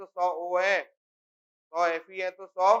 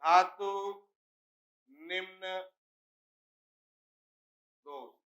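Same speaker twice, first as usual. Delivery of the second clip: word by word, long pauses between धातु, निम्न and दोष; each word set apart and drawn out; slow dictation; slower than usual. Shown as a demonstration. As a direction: dictate धातु निम्न दोष for note-takers, leaving big gaps. Teacher dictates term for notes.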